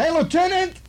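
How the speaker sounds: high-pitched call across the room; mock holler for the lieutenant; retold holler. Sergeant hollering over to his lieutenant, high-pitched call. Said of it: "when he turned and hollered".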